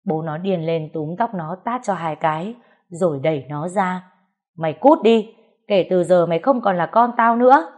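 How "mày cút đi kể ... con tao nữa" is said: loud, higher, disowning roar; enraged shout. Acted. Father throwing his own child out of the house, furious yell. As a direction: throw the child out with full fury.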